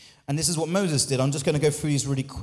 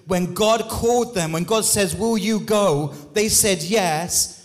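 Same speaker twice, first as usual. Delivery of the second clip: rising, louder preacher's call over music; preaching at full force; 4 semitones higher, 3 dB louder than usual. Acted. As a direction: lift the congregation, building loud and high.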